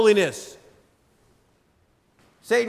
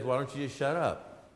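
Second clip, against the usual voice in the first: much lower and softer devil voice; a quiet, contemptuous jab; Satan's taunt; 8 semitones lower, 12 dB quieter than usual. Retold or imitated. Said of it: "Satan says".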